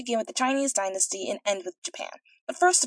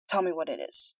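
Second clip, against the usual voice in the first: king speaking; 2 semitones lower than usual. A narrator's reading. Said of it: "said he".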